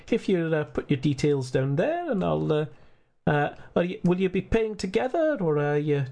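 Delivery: lightly Scottish receptionist; a hint of Scottish old gent; Scottish accent, casual. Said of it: "he hands it".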